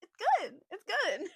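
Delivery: very high squeaky reassurance; high, loud, hammed-up politeness; overeager assurance. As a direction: squeak it, insisting too hard. Acts her insisting reply to him.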